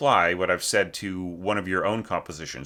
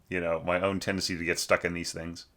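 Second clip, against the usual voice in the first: usual speaking voice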